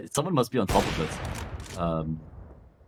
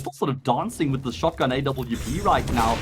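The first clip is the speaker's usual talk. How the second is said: high, excited play-by-play call; esports caster; 10 semitones higher than usual. Acted. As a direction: call it like the round hangs on it. Live call, pitch very high.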